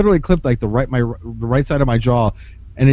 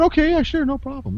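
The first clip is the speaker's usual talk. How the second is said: mock-cheerful agreement, pitched way up; a falsetto "sure, no problem"; overeager yes; 11 semitones higher than usual. Mock-eager agreeing to sparring request.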